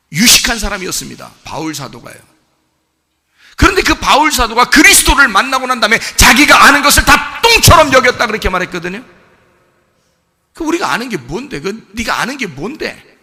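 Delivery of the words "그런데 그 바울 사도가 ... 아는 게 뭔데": louder, higher preacher's challenge; preaching at full force. Acted. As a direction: hammer each question at the flock. (힘찬 설교조로 몰아붙이며)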